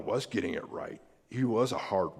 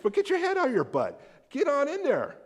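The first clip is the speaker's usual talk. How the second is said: much higher, louder fed-up father telling him off; hammed-up get in there rant; half-joking stern father; mock exasperated scolding; 4 dB louder than usual. What father had a right to say.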